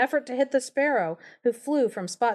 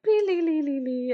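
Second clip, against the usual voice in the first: page-turn beep; 5 semitones higher than usual. Retold or imitated. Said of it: "those books where it's like".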